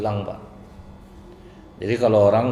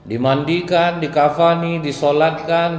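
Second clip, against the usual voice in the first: loud, high, rising sermon cry; preaching at full force; 9 semitones higher, 7 dB louder, slower than usual. Acted. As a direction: build the list to a fiery climax. Preacher building to climax. (khotbah berapi-api dengan suara tinggi dan keras)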